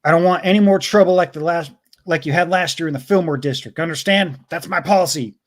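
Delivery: amateur impression of a stern superior; movie authority figure. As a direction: act out Callahan's superior giving orders. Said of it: "or impression".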